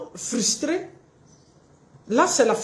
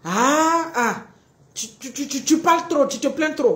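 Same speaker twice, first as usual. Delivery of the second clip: mock reproach; 4 semitones higher than usual. Acted reproach of partner.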